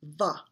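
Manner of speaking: voiced "va", lip against teeth. Shown as a demonstration. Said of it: "go ahead and try that".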